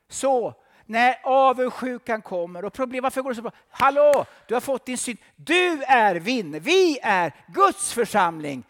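high, loud fervent preaching; full-force preaching. Acted. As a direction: preach it to the hall.

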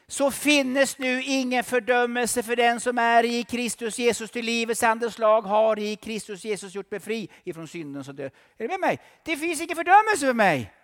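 high, loud fervent preaching; full-force preaching. Acted. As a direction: preach it to the hall.

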